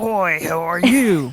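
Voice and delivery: ordinary delivery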